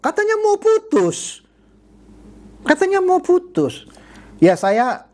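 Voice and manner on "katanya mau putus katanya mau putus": high, slow mock-surprised jab; mocking tease. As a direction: needle him with fake surprise. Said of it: "bikin nyelekit dah".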